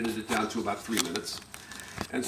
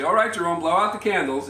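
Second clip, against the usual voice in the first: lightly imitated cheery mother, higher and louder; mother's call; 5 semitones higher, 5 dB louder than usual. Mother telling her son to blow out candles, lightly imitated. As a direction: call out brightly to the birthday boy. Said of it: "of his mother. She'll say".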